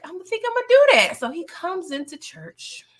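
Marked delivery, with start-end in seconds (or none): 0.0-1.2 higher, louder and rushed, mock-eager; sudden excited yes. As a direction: blurt it out eagerly. Duck agreeing, retold.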